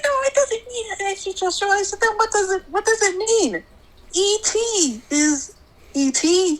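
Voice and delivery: high, fast back-and-forth; mother replaying the quarrel with her daughter, high and quick; retold argument.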